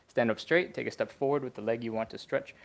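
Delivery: normal talking voice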